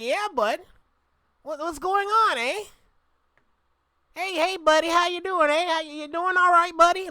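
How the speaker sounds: high-pitched put-on Canadian accent. Shown as a demonstration. Showing what Canadians sound like, put-on Canadian accent. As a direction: do a high, hammed-up Canadian buddy greeting. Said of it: "the Canadian accent is".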